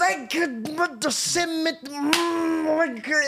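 high, strained mock fury, words choked off; held-back yell. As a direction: imitate a man who can't let himself yell. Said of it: "wanna yell at you but can't".

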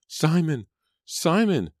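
high, piercing shrieks of his name; shrill cries. Acted. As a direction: scream his name in horror. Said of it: "Angelica's shrill cries".

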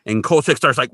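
ordinary delivery

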